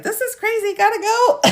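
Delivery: high-pitched mock audience voice; imitated fed-up viewers. Streamer imagining viewers leaving, mock high-pitched voice. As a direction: put on a squeaky viewer bailing out. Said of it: "you all are probably like".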